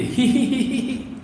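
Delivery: high, slow hee-hee giggle; a girl's teasing laugh, drawn out; giggling. Retold or imitated. Slave girl giggling as the lash falls, high hee-hee. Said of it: "تو ہنستی ہے".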